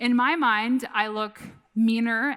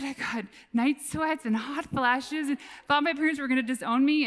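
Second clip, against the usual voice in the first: usual speaking voice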